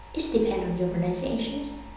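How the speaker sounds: ordinary talk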